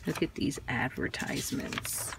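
breathy near-whisper of wonder; almost whisper it, careful not to disturb; hushed awe. Real, not acted. Showing viewers fragile old ads, hushed and awed.

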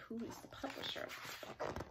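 a breathy whispered question. Acted. Teacher asking the class about the book, whispered. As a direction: whisper it like a little secret. Teacher wondering aloud to kids.

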